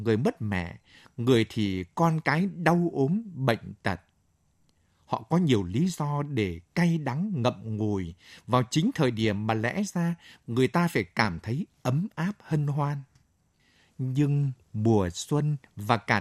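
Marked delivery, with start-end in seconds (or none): none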